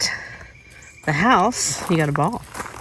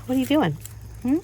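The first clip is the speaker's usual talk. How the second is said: a high sing-song question to the dog; pet talk; 7 semitones higher, 5 dB quieter, faster than usual. Real, not acted. Owner teasing her watering puppy in high pet talk.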